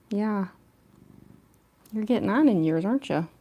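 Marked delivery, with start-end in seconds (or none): none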